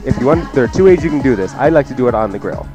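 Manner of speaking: normal talking voice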